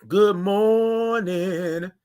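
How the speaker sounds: very slow, drawn-out "Good morning" with the r left off, "mornin'"; signature good morning. Shown as a demonstration. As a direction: stretch out "Good morning" long and lazy, no r sound. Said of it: "That's how you do it".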